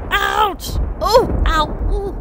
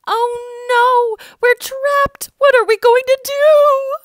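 higher, panicked and quickening; scared cry; 9 semitones higher, 4 dB louder than usual. Acted. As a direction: sound trapped with the sea ahead. Trapped people cry out.

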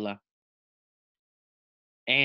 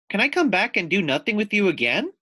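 put-on fast, louder, higher child voice; eager little girl; 6 semitones higher, 4 dB louder, faster than usual. Layla eagerly asks the lizard to come back. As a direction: ask it in a happy rush.

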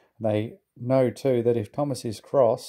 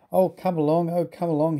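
impatient puffing; 6 semitones higher than usual. A narrator's reading. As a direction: hurry them along impatiently. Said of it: "he puffed impatiently".

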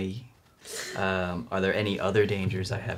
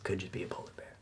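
hushed, voiceless whisper; 12 dB quieter, faster than usual. Acted. Player guessing at the sleeping creature, whispering.

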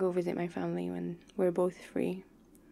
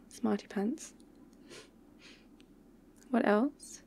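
normal talking voice

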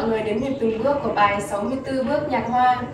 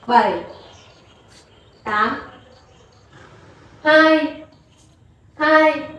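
counting dance beats; 2 semitones higher, much slower than usual. Shown as a demonstration. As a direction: call the beats like a dance teacher. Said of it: "thầy [name] sẽ hướng dẫn đếm nhịp cùng hướng". (đếm nhịp nhảy theo nhạc)

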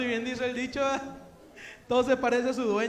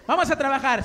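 usual speaking voice

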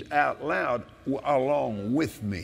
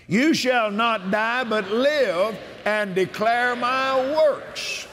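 preaching at full force; 7 semitones higher, slower than usual. Acted. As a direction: shout the promise like from the pulpit.

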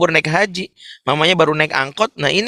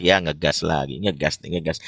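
much lower, softer sulky muttering; low sulky mumble; grumbling teen; 10 semitones lower, 7 dB quieter than usual. Retold or imitated. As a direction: grumble it under your breath like a teen.

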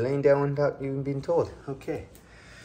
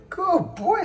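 much higher squeaky praise; squeaky praising a dog; 6 dB louder than usual. Real, not acted.

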